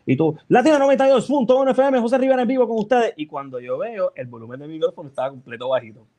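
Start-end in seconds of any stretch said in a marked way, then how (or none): 0.5-3.1 high, fast announcer call; energetic radio intro. Retold or imitated. Host reenacting his energetic on-air opening, announcer voice. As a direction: blast it out like going live. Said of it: "tú entras bien energético".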